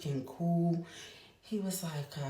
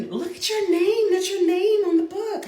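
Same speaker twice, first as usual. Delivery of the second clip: put-on much higher, louder delighted child; excited son; 12 dB louder than usual. Mother imitating her son spotting her name on the cover, high excited child voice. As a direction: point it out like a thrilled kid. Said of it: "He was so super excited".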